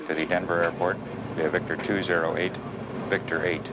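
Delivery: low voice over the radio. Acted. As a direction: read the clearance like radio. Clearance read over radio.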